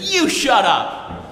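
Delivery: loud, slow, insistent shout; match his yelling, but with love; preacher yelling back at a drunk. Retold or imitated. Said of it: "I met him in his language".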